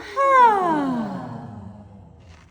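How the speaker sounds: big audible sigh, falling; loud exhale sigh. Shown as a demonstration. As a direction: let the breath out on a long sigh. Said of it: "exhale on a sigh".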